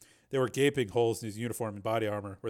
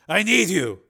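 loud, raised, urgent plea; desperate pleading; 8 semitones higher, 11 dB louder, slower than usual. Acted. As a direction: beg her to stay. Man begs her to stay.

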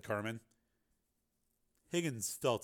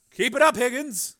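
cold taunt; 12 semitones higher, 11 dB louder than usual. Acted. Carmen taunting.

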